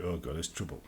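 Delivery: wary thought. Retold or imitated. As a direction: dread under the breath. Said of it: "they think".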